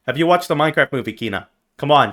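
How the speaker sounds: ordinary talk, nothing marked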